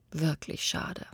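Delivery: soft, voiceless sigh of regret; regretful whisper. Acted. Tree regrets it has nothing to give, whispering. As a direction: let it fall away in a breath.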